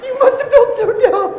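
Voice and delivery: loud, very high squeal of mock hilarity; wife laughing helplessly. Husband imitating his wife teasing him, shrill helpless laughter. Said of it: "she was laughing so hard".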